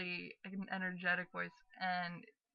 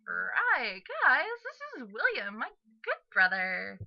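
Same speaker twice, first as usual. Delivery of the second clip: put-on loud, high, swooping girl's voice; perky introducing sister; 5 semitones higher, 9 dB louder, slower than usual. Sister introducing her brother to roommates, high and loud. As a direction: swoop way up while presenting him.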